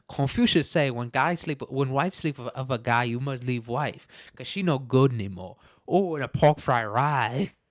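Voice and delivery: put-on caricatured Chinese accent, slowing on the last line; mock Chinese accent. Redoing the misquoted Confucius joke, mock Chinese accent. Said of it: "funny to do a Chinese accent".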